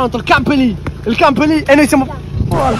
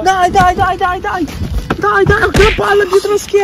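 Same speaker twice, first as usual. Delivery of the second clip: excited cheering; 9 semitones higher, slower than usual. Real, not acted. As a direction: pump up your friend. Hyping a friend on.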